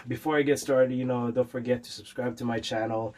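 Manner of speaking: ordinary talk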